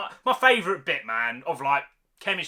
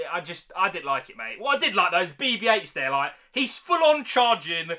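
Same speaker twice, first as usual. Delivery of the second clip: ordinary delivery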